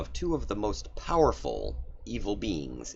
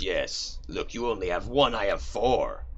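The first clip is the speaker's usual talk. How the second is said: louder, gloating demon; boastful Orcus; 4 semitones higher, 3 dB louder than usual. Acted. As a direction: brag like a child with more toys.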